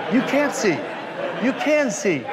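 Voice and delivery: high, loud mock exasperation; arguing with herself. Actress torn over the magician's sight, arguing loudly with herself. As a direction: flip between verdicts, rising in pitch. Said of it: "she's sitting there arguing with herself".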